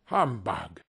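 low, curt grumble; dismissive scoff. A narrator's reading. Scrooge repeats his dismissal.

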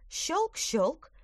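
high, bright snip snip; snipping sound. A narrator's reading. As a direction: mimic claws cutting net. Claws snipping the net.

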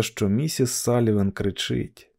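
plain reading voice

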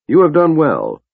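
high, firm praise; commanding High Lord. Acted. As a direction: reward him with cool authority.

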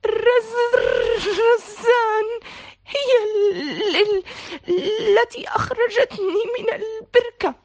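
high, slow, broken crying voice; tearful stammer. A narrator's reading. Tarek shivering and crying as he tells who saved him. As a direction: stutter through tears, cold and shaken. Shivering boy after falling in.

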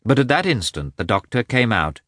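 ordinary delivery, nothing marked

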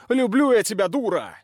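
high shouted confession; shouting. A narrator's reading. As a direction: yell it at her. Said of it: "кричит Оскал". (кричит, признаваясь)

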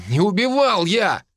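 high, loud denial; indignant shout. Acted. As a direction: cry out the denial. Suspect denies murder accusation.

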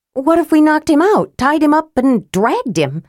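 eager. Acted. Tika's rash suggestion.

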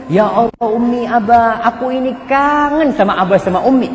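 high-pitched, mildly hammed-up emotional wail; imitating tearful daughter. Crying wife telling her parents she missed them, imitated tearfulness. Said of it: "nangis tuh perempuan".

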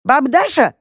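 put-on very high little-girl voice; girlish squeal. A narrator's reading. Girl in the box calls granny, squeaky. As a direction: pipe up excitedly like a girl. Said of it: "запищал ящик девчачьим голосом".

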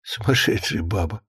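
low, soft, amused grumble; wry mutter to himself. A narrator's reading. Husband alone after wife hangs up, wry low mutter. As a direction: mumble it privately. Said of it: "сам себе улыбнулся Ефим".

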